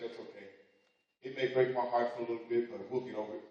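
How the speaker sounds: mother gently swallowing her hurt; softly hurt mother. Acted. Mother accepts heartbreak softly.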